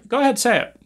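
taunting dare. Real, not acted. Taunting challenge, high.

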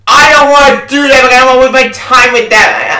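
loud, high frustrated yell; angry shout. Real, not acted. Angry player refusing another playthrough, loud shout.

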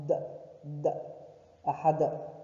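d then d then ahad with the final d released; bounced dal. Shown as a demonstration. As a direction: pop the d and let it echo. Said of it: "da with sukoon".

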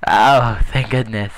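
loud burst of relief after a scare; big relieved exclamation; huge relief. Real, not acted. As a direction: let the panic out, loudly relieved. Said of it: "just had a heart attack".

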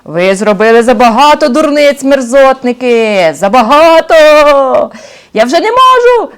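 високо, гучно, у відчаї; заволай, що більше не витримуєш; вереск злодюги. Acted. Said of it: "заверещав більший злодюга". (великий злодюга верещить від жаху)

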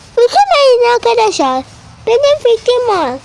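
high little toy-character voice; high-pitched pupil character. Pretend. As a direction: pretend schoolchild, voice pitched well up. Pupil character comforts classmates with idea.

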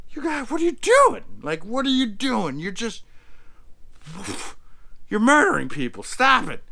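a retold man begging them to quit murdering; frantic plea. Ben begging the Bird family to stop killing, high frantic plea.

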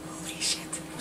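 whispered exclamation; quiet startled curse. Real, not acted. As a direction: hiss it under your breath in disbelief. Outtake exclamation.

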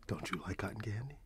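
ordinary delivery

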